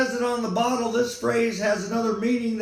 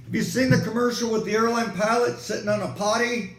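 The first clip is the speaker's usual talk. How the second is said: ordinary delivery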